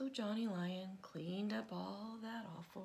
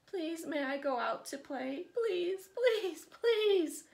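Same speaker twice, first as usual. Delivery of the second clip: pleas rising much higher and louder; begging; 9 semitones higher, 7 dB louder, slower than usual. A narrator's reading. Johnny Lion begging Mother to play outside, higher and louder. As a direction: beg harder each time.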